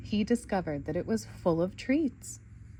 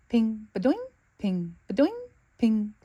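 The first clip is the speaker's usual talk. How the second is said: pinging noises; 2 semitones higher, 4 dB louder than usual. Acted. Arrows bouncing off shield.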